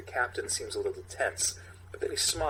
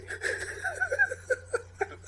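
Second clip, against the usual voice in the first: high wheezy laugh. Real, not acted. Laugh after the line.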